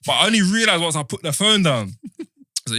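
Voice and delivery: ordinary delivery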